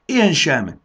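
put-on a young dragon shouting her loyalty; shouted. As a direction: call out in bold support. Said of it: "kiáltotta Sári".

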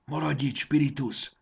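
plain reading voice

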